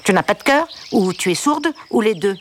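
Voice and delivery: indignant, exasperated questions; annoyed duck. A narrator's reading. Upset duck scolding Olga for ignoring him, annoyed. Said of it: "le canard s'énerva".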